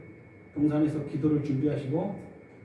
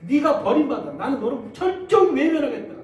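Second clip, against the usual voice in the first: grieved declaration; 3 semitones higher, 7 dB louder than usual. Retold or imitated. God forsaking the son.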